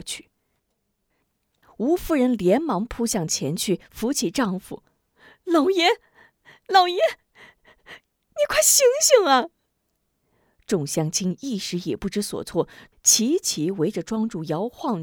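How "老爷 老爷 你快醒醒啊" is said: high, slow, sobbing cry; crying plea. Acted. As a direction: wail for him to wake up, crying. Wife begs fainted husband.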